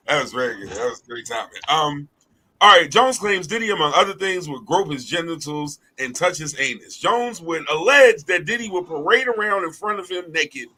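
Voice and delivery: usual speaking voice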